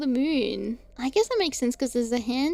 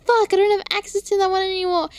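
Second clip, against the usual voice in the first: louder, higher, rushed retold panic; panicked outburst; 7 semitones higher, 9 dB louder, faster than usual. Streamer replays her login panic in a rushed, louder outburst. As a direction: blurt it out in a rush of panic. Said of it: "I was like".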